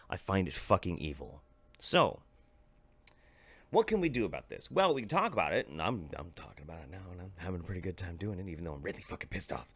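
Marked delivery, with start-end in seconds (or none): 5.7-9.7 softer, lower, fast muttered aside; quiet fast self-aware aside; quick aside. Real, not acted. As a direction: drop the volume and rush it. Quiet fast aside while ranting.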